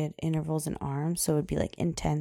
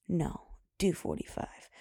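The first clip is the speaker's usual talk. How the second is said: stern whispered self-talk; 5 dB quieter than usual. Retold or imitated. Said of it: "And I was like".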